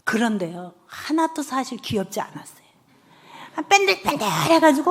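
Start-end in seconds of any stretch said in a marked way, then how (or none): 0.9-2.7 a hushed, barely voiced aside; whispered confession. Real, not acted. 강연자가 청중에게 몰래 털어놓듯 속삭이며. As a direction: confide it under your breath. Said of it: "confessing aside to audience".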